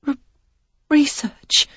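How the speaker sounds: a stammered, high, frightened word; shaky stammer of an excuse. A narrator's reading. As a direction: stumble over the first syllable, timid. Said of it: "the one stammering word".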